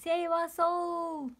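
high, loud, slow wail; desperate plea. Acted. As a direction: beg for everyone's rescue. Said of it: "cried the grayberry".

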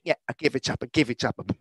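usual speaking voice

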